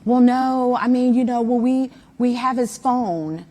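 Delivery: loud retelling. Retold or imitated. Mom retells Warren's admission.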